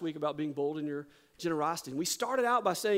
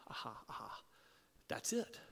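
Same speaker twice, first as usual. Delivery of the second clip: soft breathy aha of discovery; hushed realization; 8 semitones lower, 12 dB quieter than usual. Retold or imitated. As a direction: hush it like a secret insight. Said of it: "I thought".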